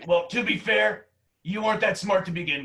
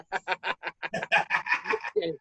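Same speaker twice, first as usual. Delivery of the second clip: rapid run of chuckles; amused laughter; 3 dB quieter than usual. Acted. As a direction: laugh heartily at your own old story. Laughs at his own college memory.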